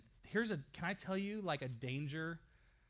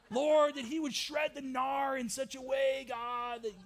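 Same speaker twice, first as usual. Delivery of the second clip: higher, louder, slow surfer-dude plea; surfer bro prayer; 8 semitones higher, 5 dB louder, slower than usual. Retold or imitated. Pastor imitating surfer praying, higher and louder. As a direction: beg God to shred the gnar. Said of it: "I love his prayers 'cause it was like".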